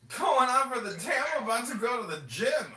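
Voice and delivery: loud, very high put-on eagerness; hammed-up pumped-up reply; mock enthusiasm. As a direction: boast of big plans as an obvious joke. Joke reply, then says he's kidding.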